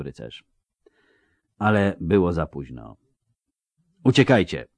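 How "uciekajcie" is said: plain reading voice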